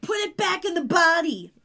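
imitated yell. Phoebe yells at Paige, mock exasperated shout. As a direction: yell it at her, only half in earnest. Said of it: "And Phoebe yells at her".

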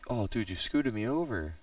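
normal talking voice

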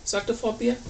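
ordinary delivery, nothing marked

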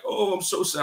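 sarcastic fake-sad voice; mock sadness. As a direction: pretend to be wounded, not meaning it. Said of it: "front and act like if I heard that".

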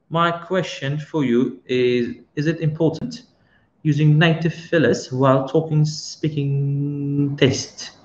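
slow, deliberate rereading of the question; unhurried repeat for clarity; slow careful reread. Shown as a demonstration. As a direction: spell the question out slowly so it lands. Question read again slowly.

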